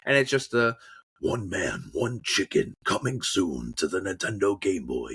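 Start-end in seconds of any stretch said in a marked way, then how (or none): 1.2-5.1 deep, dramatic announcer read; low movie-trailer voiceover; game trailer voice. Shown as a demonstration. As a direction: sell it low like an epic film trailer. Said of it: "a trailer for a game".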